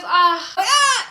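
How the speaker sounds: high-pitched mortified "ah"; retold yelp rising very high; awkward cry. Woman fleeing after rejection, high awkward wail. Awkward cries before running away.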